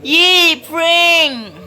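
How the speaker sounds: high, loud happy cheer; excited cheer. Real, not acted. Cheering baby's little prank.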